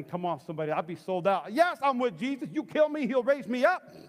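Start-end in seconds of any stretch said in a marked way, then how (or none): 1.5-3.9 high, loud, bold rallying cry; fervent declaration. Acted. Preacher imagines being sold out for Jesus, high fervent declaration. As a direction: proclaim total loyalty.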